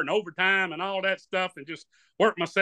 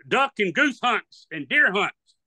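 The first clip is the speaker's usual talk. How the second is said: ordinary delivery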